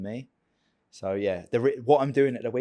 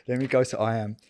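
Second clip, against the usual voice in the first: ordinary talk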